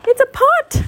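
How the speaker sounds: very high excited call. A narrator's reading. Roo spotting a pot under the bridge, excitedly. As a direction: call out the find, pitch leaping up. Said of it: "Roo called excitedly".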